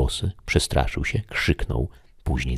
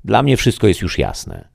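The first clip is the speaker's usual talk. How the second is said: the voice; 6 semitones higher, 4 dB louder than usual. Acted. The voice to the shadow.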